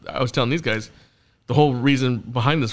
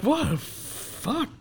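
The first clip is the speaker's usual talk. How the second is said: slow, high, softer retold disbelief; stunned disbelief; 11 semitones higher, 8 dB quieter, much slower than usual. Host replies to the goading crowd, stunned. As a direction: draw it out high and quiet, stunned.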